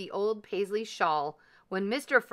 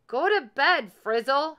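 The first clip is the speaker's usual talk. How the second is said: loud, higher-pitched shout; shouted order; 7 semitones higher, 7 dB louder than usual. A narrator's reading. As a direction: shout him off to bed. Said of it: "shouted Gus".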